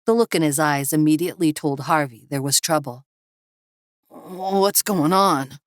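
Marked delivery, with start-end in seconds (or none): 4.1-5.6 slow, higher, bewildered; sleepy puzzled question; groggy young man. Acted. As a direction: drag it out, half awake. Harvey just woken, sitting up.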